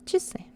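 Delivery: часы with its A reduced to a short "i"; unstressed reduced A. Shown as a demonstration. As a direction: say часы as "chi-SY", stress at the end. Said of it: "When A is not stressed".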